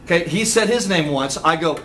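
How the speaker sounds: ordinary talk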